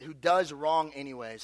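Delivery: normal talking voice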